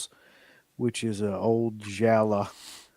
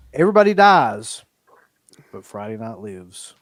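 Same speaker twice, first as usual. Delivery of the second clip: sign-off catchphrase; 3 semitones lower, 5 dB louder than usual. Acted. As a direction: deliver it like a show tagline.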